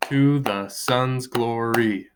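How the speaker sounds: to the sun's glory, slowly, one beat per syllable; slow count-along reading. Shown as a demonstration. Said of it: "So five syllables".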